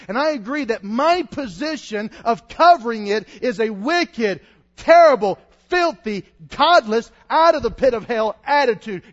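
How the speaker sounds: loud, impassioned pulpit voice, pushing each adjective; fiery preaching. Acted. Preacher pleading with the congregation, loud and impassioned. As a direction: thunder it from the pulpit.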